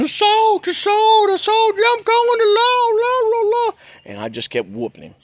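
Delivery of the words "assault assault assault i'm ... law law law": high, loud mocking scream of a panicked dad. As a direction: shriek it like a father calling for the law. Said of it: "he's just screaming".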